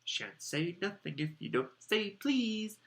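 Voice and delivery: slow, high, taunting lilt; annoying sing-song. A narrator's reading. Peeves refusing to help Filch, annoying sing-song. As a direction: chant it to needle him, rising at the end. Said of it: "said Peeves in his annoying sing-song voice".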